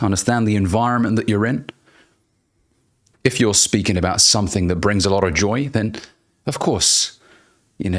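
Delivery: ordinary talk, nothing marked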